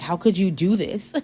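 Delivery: hammed-up astonished question; acted surprise. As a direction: accuse in disbelief, about to laugh. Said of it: "These people are so surprised".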